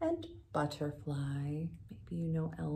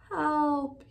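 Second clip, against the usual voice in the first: higher, louder shout for help; 8 semitones higher, 6 dB louder than usual. A narrator's reading. Elmer stuck on the cliff, higher loud shout. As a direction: yell for help. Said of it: "he shouted".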